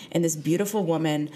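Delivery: normal talking voice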